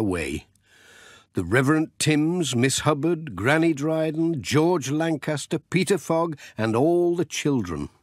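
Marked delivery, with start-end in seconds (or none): none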